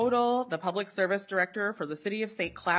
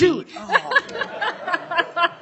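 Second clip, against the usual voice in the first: loud, high, through laughter; real laughing; 11 semitones higher, 8 dB louder than usual. Real, not acted. Presenter to colleagues after the impression, laughing loudly. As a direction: blurt it out while laughing.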